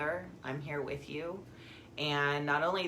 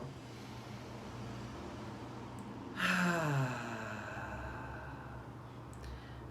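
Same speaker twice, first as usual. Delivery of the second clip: long, soft voiced exhale; releasing sigh; 3 semitones lower, 7 dB quieter than usual. Shown as a demonstration. Guide shows listeners letting it fall out, long sigh. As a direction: sigh it all out, letting it fade.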